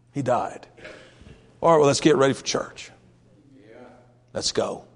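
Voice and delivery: low, hushed, heavy-hearted reply; subdued, resigned David. Acted. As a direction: say it softly, trailing almost to nothing. Acting David after child's death.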